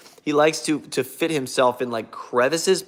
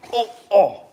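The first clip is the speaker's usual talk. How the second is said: sudden loud higher oh; startled; 8 semitones higher, 7 dB louder than usual. Real, not acted. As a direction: caught off guard by the dog. Dog climbing on him.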